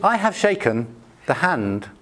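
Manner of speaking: usual speaking voice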